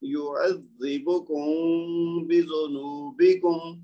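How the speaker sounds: very slow, the م of یعذبکم hidden in the nose; recited with ghunna. Shown as a demonstration. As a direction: hold the م of یعذبکم nasally, lips apart, then بذنوبکم. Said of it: "تو کیا ہوا؟".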